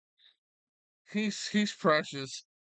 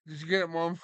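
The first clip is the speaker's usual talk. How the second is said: ordinary talk, nothing marked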